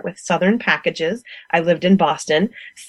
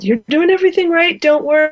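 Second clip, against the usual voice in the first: quoted reassurance; 11 semitones higher, 3 dB louder, faster than usual. Retold or imitated. Beekeepers reassuring the new beekeeper.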